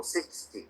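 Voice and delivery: "Sixty" with SIX stressed, no N at the end; stress on first syllable. Shown as a demonstration. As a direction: model "Sixty" as SIX-ty for the student. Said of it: "then you have to stress the first syllable".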